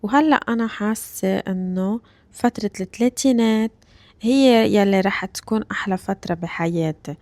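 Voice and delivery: ordinary delivery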